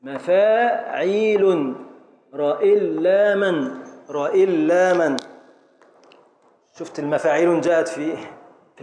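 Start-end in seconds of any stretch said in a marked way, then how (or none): 0.0-5.2 "مفاعيل" and "راء إلا من" in drawn-out syllables; metrical scansion. Shown as a demonstration. Teacher matches words to the foot.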